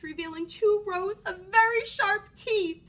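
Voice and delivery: plain reading voice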